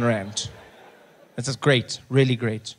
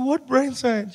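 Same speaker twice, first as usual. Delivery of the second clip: incredulous question; 9 semitones higher, slower than usual. Retold or imitated. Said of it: "I said".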